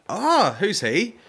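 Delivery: high, slow put-on innocent voice; high mock-innocent question; mock clueless. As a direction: play dumb in a high drawn-out voice. Feigning ignorance about Liam Neeson.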